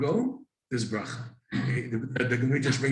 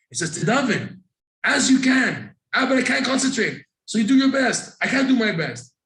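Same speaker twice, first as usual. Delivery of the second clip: loud, high back-and-forth protest; heated argument; 10 semitones higher, 6 dB louder than usual. Acted. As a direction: argue both sides, pitched up and loud. Acted back-and-forth argument with objector.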